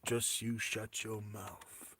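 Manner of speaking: slow, low, very soft song quote; hushed, drawn-out rock-singer imitation. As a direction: drop right down and draw the words out quietly. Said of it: "you'll be like [name] in that China Girl song".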